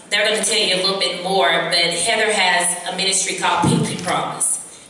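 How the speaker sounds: ordinary talk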